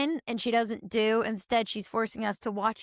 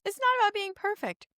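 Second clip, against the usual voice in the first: high-pitched mock of her saying it; lightly mocking imitation; mocking quote; 10 semitones higher than usual. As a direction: quote her in a lifted, put-on voice. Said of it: "The amount of times she says".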